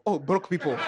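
ordinary delivery

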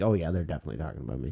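fast, sure oh yeah; fast mock realization. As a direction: rattle it off as a quick aside. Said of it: "add that one little thing after".

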